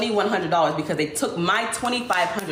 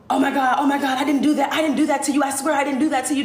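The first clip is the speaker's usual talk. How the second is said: freaking out; 7 semitones higher, faster than usual. Retold or imitated. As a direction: panic and plead your innocence, fast at the end. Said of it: "And she freaks out".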